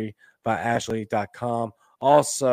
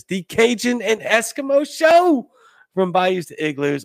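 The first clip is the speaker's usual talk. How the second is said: show hype; 9 semitones higher, 6 dB louder than usual. Real, not acted. Hyping his Friday show.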